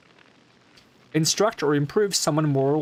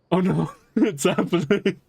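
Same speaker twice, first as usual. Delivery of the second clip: laughing; 9 semitones higher, 5 dB louder than usual. Real, not acted. Laughing at singing request.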